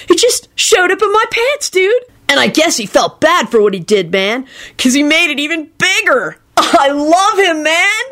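high-pitched, gushing adoration; worshipful. A narrator's reading. Kenny praising his benefactor, worshipful. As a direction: gush in an elated high voice. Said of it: "he said worshipfully".

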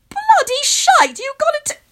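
high, loud, stunned outburst; shocked cry at the bank hack; blurting out. A narrator's reading. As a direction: blurt it in disbelief. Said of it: "I blurt out".

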